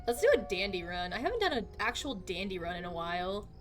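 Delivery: ordinary delivery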